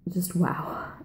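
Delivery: breathless whispered wow; hushed, awed wow about a perfect book. Real, not acted. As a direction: whisper it, awestruck.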